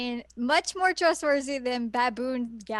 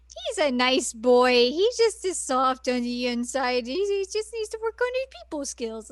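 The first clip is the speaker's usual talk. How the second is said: mock cooing defence; 2 semitones higher, 3 dB louder than usual. Kagome petting his ears and defending him, mock doting coo.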